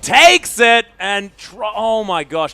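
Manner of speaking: loud, high caster yelp; startled shout, easing into amazement; excited outburst. Real, not acted. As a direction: burst out as the play lands. Caster reacts to sudden play.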